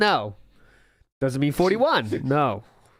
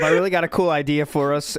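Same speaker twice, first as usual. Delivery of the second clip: ordinary talk, nothing marked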